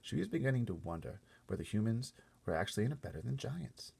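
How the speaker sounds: plain reading voice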